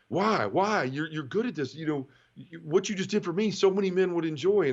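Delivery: high, pleading why why; excited insistence. Retold or imitated. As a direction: push him, high and eager. Jay insists, pushing back.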